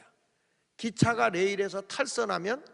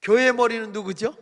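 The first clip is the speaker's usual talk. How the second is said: louder, higher-pressured call for a response; louder repeated question; 3 semitones higher, 10 dB louder than usual. Real, not acted. Preacher prompting the congregation for an answer, loud repeat. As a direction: ask it louder to get them answering. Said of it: "다시".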